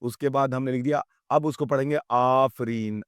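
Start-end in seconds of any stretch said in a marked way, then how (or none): none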